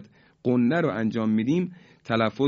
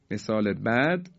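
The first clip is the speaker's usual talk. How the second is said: ordinary delivery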